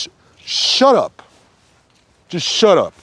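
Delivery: high, slow, fed-up outburst; exasperated high retort; exasperated shut up. Real, not acted. Fed up with imposed role.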